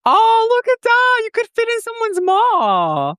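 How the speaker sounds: high squeaky shrunken voice filter; tiny voice. Pretend. Streamer shrunk tiny cooing at co-host, tiny voice. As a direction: sound pocket-sized and cooing. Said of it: "Let's just make me tiny".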